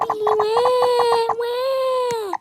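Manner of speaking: high, hammed-up wailing "wah wah"; fake crying. Kid fakes crying in blackout.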